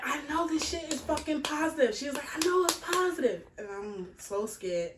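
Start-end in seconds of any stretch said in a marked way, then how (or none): 0.0-3.5 high, raised voice of a furious mother; mother screaming. Retold or imitated. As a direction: mom yelling through the bathroom door. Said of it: "she's screaming".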